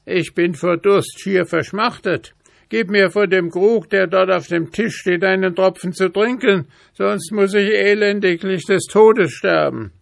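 a parched raven crying for a drop; desperate raven. A narrator's reading. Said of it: "schrie der Rabe". (hohe, laute Rabenstimme, verdurstend)

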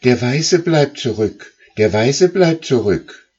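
high, loud calling goose voices; high, loud geese crying out. Acted. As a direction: shout the warning up the line. Rear geese report.

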